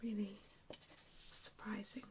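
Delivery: usual speaking voice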